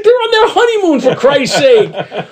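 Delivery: high-pitched, exasperated mock wife; imitated scolding wife. Wife telling husband off over honeymooners, mock exasperation. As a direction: scold him in a high put-on voice, stretching the end.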